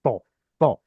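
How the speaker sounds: "to" with a heavy, backed T; emphatic Tet. Shown as a demonstration. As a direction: demonstrate an emphatic T in "to". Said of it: "Tet was emphatic".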